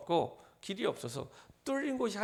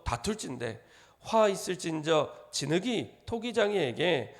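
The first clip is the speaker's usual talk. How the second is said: slow, loud, measured communal recitation; unison scripture reading; 5 dB louder than usual. Acted. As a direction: read the verses aloud together, slow and solemn. Congregation reads scripture aloud together. (회중과 함께 천천히 크게 성경 봉독)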